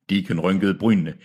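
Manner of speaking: usual speaking voice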